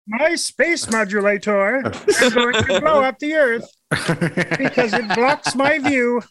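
high cartoon alien villain voice; Marvin the Martian. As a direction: scheme like a little cartoon Martian. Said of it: "Marvin the Martian".

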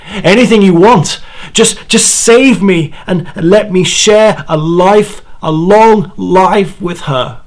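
high, loud, pleading cry to the spirits; desperate cry. Acted. Dying man crying out to the spirits, loud desperate plea. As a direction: beg for your life at the top of your voice. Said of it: "I cried out to whatever spirits".

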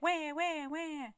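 put-on high, soft baby-like "wah"; mock wailing. Co-host mocking Columbus being sent home, high mock crying. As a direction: whimper out a fake little cry. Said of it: "And he was like".

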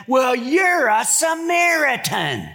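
high, slow stumbling insult; flustered taunt. Retold or imitated. Accusers flailing at Jesus, high slow taunt. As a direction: sputter it like a stuck kid.

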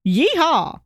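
excited yeehaw. Shown as a demonstration. Demonstrating the right tone, an excited whoop. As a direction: yell it like you mean it. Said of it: "it's all about the tone of voice".

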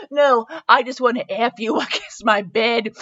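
breaking into laughter mid-line; laughing. Real, not acted. Reader cracking up at a racy line, laughing. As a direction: try to read it and crack up.

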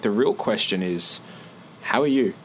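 slow, deliberate "how are you?"; slow question. Shown as a demonstration. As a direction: lean in and ask each word slowly. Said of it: "I'll ask it slowly".

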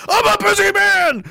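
high, loud comic outburst; mock yelling. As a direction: yell it in exaggerated exasperation. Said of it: "five minutes yelling at you".

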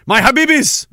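calling out. Real, not acted. Streamer loudly greeting the chat. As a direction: call it out to the chat.

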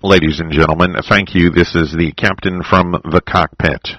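low, loud airline captain announcement; pilot voice. Acted. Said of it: "They all have that pilot voice".

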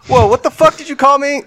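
high, fast shocked outburst; recoil, outraged and quick; hammed-up outrage; mock offended. Milkman offended in sketch retell.

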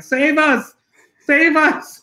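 high mock plea from the dogs; pleading dogs. Voicing the dogs begging to be rescued, high plea. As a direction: cry for rescue like the trapped dogs.

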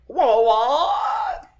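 high squealing whoa while laughing; shocked squeal. Retold or imitated. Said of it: "And they're like".

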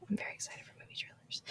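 whisper. Real, not acted. Jokey aside about Super Bowl trailers.

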